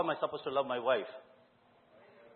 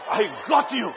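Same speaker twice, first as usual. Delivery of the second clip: triumphant laugh; 6 semitones higher, 5 dB louder than usual. Real, not acted. Preacher catching dozing congregation, laughing triumph. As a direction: gloat playfully over the sleepers. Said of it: "For those of you who are sleeping".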